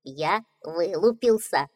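cheerful little duckling. Acted. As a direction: voice the young duckling brightly. Duckling voice in read fairy tale.

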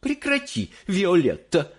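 high-pitched mother cutting in; high female character voice; миссис Бурегард interrupting. A narrator's reading. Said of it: "вмешалась миссис Бурегард".

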